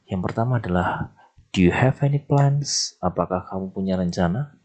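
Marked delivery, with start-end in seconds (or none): 1.6-3.0 English question read with a lifted tone; reading the example question aloud. Shown as a demonstration. Teacher reading the sentence to students, higher voice. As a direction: model the question for the class. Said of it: "seperti itu ya bacanya".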